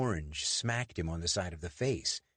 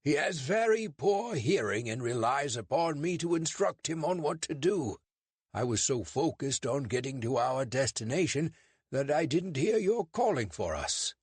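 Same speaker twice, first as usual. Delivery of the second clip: David's put-on British accent, pitched high; 7 semitones higher, 3 dB louder than usual. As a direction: fake a polite British accent to fool a constable. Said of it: "mustering up his best British accent".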